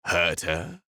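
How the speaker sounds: mocking echo. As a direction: toss the words back lazily. Renaud mocks the plea.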